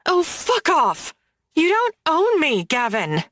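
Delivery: high, shouted fury; furious outburst. Acted. Woman shouting at the man who claims to own her, furious. Heroine erupts at possessive Gavin.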